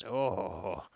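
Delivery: usual speaking voice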